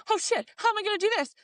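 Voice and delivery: high, alarmed panic; sudden rush of high alarm; panic again. Retold or imitated. Back to panicking over the divorce, high alarm. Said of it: "And then I'm like".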